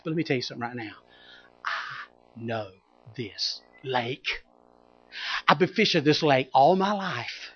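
soft, muttered grumbling to himself; Peter's inner thoughts. Acted. Fisherman Peter privately doubting Jesus, quietly sceptical. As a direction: grumble it under your breath. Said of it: "This is what he's thinking".

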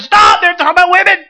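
sudden high, loud shout; a wife's alarmed shout; Jill's shout. Retold or imitated. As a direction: yell to stop him. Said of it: "And Jill said".

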